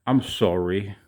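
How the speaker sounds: mock Canadian "sorry" with a rounded "soh-ree"; Canadian accent. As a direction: say "I'm sorry" like a Canadian, "sorry" with an "oh" sound. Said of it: "Or as a Canadian would say".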